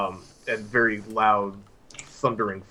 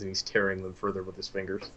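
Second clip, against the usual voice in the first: normal talking voice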